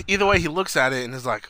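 usual speaking voice

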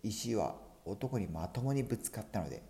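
plain reading voice

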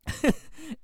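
squeaky laugh through tears; high emotional laugh. Real, not acted. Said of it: "crying like that".

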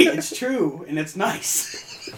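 normal talking voice

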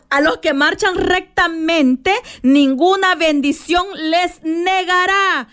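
slow, measured recitation of the promise; solemn scripture reading. Acted. Preacher recites the psalm's promise to her congregation, slow and solemn. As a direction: lay each word down slowly like a sacred vow. Quotes the psalm verse slowly.

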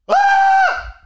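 sudden high scream of terror; retold scream. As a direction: shriek like something grabbed you. Grabbed by figure, freaking out.

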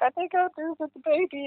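high, soft, near tears; quiet, choked-up words; breaking down. Retold or imitated. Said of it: "I literally just broke down".

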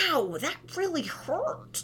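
high, slow mock ouch; playful ow. Shown as a demonstration. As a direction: make light of the hurt, playfully. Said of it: "make light of".

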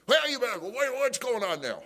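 high, loud mock bluster; hothead judge. Judge answering the sheriff's call, angry. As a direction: imitate an irate judge on the phone. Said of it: "he was a hothead".

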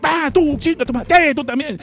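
high, ranting cartoon monster; high comic monster rant; angry kaiju. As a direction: rage at the city like a cranky monster.

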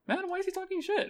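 imitating a friend's whiny gripe; mock whiny friend. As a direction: tease him with a high whine. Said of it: "you're just like".

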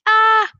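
loud, high "Ah!" thrown back; echoed cry. A narrator's reading. Voice from the mountains, loud echoed cry. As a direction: call it out like an echo.

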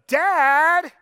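demanding dad call. Shown as a demonstration. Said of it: "If my child goes".

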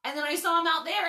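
usual speaking voice

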